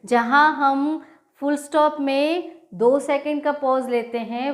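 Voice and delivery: ordinary talk, nothing marked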